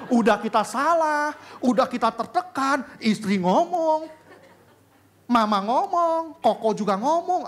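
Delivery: high, loud, slow exasperation; exasperated outburst. Acted. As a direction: pour out the frustration. Venting pressure from family. (mengeluh keras karena tertekan keluarga)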